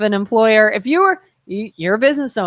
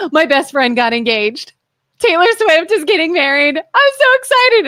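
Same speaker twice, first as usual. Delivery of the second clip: mock excited mom; 8 semitones higher, 3 dB louder than usual. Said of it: "And she's like".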